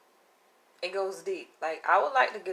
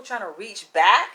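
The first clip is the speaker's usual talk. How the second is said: ordinary delivery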